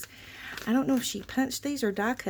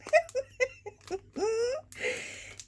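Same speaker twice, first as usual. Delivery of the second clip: laugh-cry; 13 semitones higher than usual. Real, not acted. Overwhelmed by gift, shaking.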